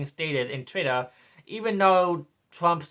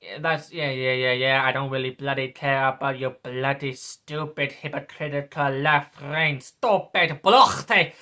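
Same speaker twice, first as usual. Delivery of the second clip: normal talking voice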